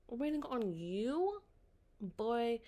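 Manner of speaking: slow, high disbelieving question; incredulous. Real, not acted. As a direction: stretch it out in disbelief. Incredulous retort at the boy.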